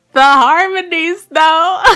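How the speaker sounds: loud, high, drawn-out "the harmonies though"; emphatic gushing. Real, not acted. Vlogger raving about her cover, gushing loudly. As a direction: belt out "the harmonies though" in delight.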